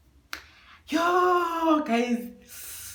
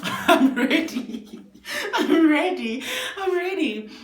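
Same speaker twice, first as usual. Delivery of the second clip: laughing; 8 semitones higher, slower than usual. Real, not acted. Giddy laughing at flirting.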